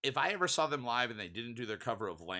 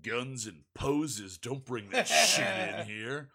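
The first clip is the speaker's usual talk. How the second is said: put-on slow, louder dismissive growl of an old metalhead; a grumpy bar regular shutting down a kid, slow and loud; gruff old metalhead; 6 dB louder, much slower than usual. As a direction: scoff the kid down slowly. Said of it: "the guy turned to him and went".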